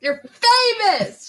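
high, slow mock cheer; mock excited cry. Teasing cry.

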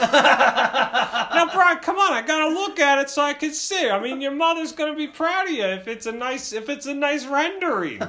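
high, insistent dad imitation; exasperated dad. Dad coaxing son about the drawing, mock exasperated. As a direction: reason with your kid in a hammed-up dad voice. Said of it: "he's just so exasperated".

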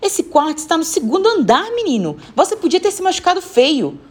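high-pitched alarmed telling-off; scolding. Acted. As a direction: scold a child who could have been hurt. Woman scolds boy after fall. (bronca aflita e aguda)

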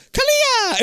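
very high, loud mock chant; hammed-up ritual cry. Podcaster mimicking a movie incantation, shrieked high. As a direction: shriek the spell like a cult priest.

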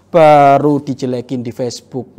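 acting out someone easily provoked; mock outrage. Mocking quick offence online.

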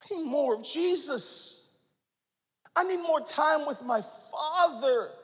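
ordinary delivery

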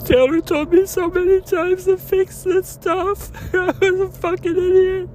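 laughing bitterly through tears; crying. Real, not acted. Man telling viewers what Taylor warned him, crying. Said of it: "nobody knows I'm crying".

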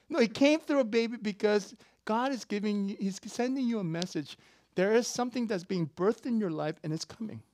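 loud, high climactic preaching; preaching at full force. Acted. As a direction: lift the congregation to the peak. Preaching continues.

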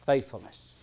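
ordinary talk

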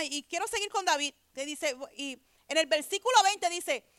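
usual speaking voice

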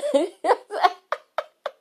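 squealing laugh, high and loud; real embarrassed laugh; high burst of laughter. Real, not acted. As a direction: laugh out at yourself. Laughing at own confession.

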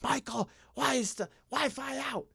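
high, strained imitation of the wife; mock wife voice, high pitched; wife's complaint. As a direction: whine it way up high like the annoyed wife. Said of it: "It's all depending on".

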